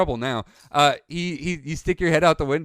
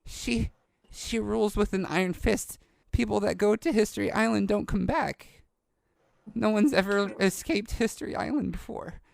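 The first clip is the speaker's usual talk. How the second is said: put-on higher, grave young boy; halting, awed boy character; young boy, fearful awe; 10 semitones higher than usual. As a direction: warn of a dreaded ruler in a boy's voice. Game master plays awed young boy.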